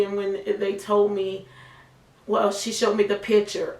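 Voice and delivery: ordinary talk, nothing marked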